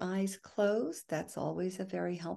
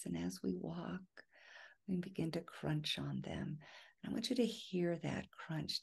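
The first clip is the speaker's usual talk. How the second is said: soft, unhurried guided-imagery voice with long pauses; guided meditation; 8 dB quieter than usual. Real, not acted. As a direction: ease listeners into relaxation, phrase by phrase. Continues the imagined walk.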